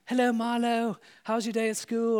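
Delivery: put-on a chirpy British mother greeting her boy; British mum voice. Mother greeting son from school, chirpy British mum voice. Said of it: "his mom's like".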